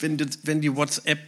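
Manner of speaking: usual speaking voice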